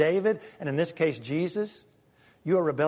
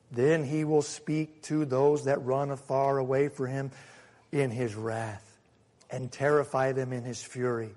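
low, softer, slowing solemnly; grave scripture reading; 5 semitones lower, 4 dB quieter than usual. A narrator's reading. Preacher reading God's wrath to congregation, grave and low. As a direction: read it as a sober warning.

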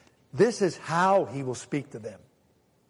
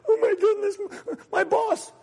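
high-pitched mock dismay; wimpy fretting. God as a wimp fretting over your boss, mock panic. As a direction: whimper like a helpless little wimp. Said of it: "God's this little wimp".

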